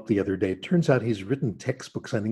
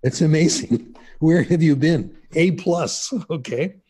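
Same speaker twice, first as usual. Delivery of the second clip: louder and higher, retelling an examiner gushing; delighted praise; 5 semitones higher, 5 dB louder than usual. Retold or imitated. French examiner gushing over the mocking student, delighted. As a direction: gush like a thrilled teacher handing out an A.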